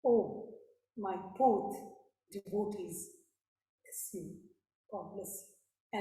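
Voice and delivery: low, soft, slow blessing; divine blessing. Acted. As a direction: bless the devotee tenderly. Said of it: "And he says".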